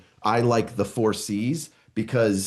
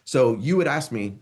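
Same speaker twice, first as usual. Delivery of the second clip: ordinary talk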